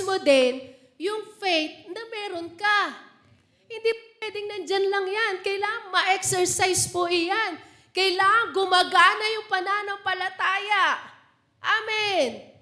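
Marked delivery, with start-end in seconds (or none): none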